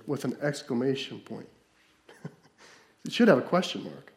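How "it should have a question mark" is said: ordinary talk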